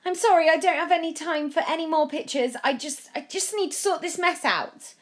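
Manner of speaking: put-on flustered boy. A narrator's reading. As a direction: brush them off in a fluster. Joe flustered refusing pictures.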